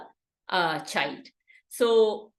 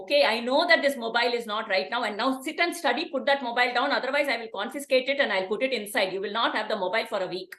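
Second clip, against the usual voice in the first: bossy parent; 3 semitones higher than usual. Acted. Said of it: "I will be a parent".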